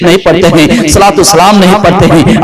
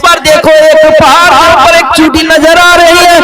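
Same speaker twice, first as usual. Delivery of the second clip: raised, sing-song preaching of the story; 7 semitones higher than usual. Acted. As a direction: preach the tale to the gathering.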